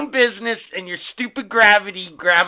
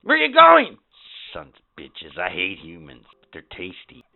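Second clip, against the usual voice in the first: low, soft alien grumble; grumbling alien; 12 semitones lower, 5 dB quieter than usual. Acted. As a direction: mutter like a disgruntled alien. Alien muttering after.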